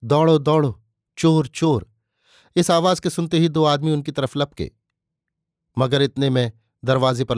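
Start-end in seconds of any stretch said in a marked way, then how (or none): 0.0-1.9 narrator's raised, urgent cry; a restrained shout of alarm. A narrator's reading. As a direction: call out for help, higher and a little louder. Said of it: "बाबू अमृत राय चिल्लाए".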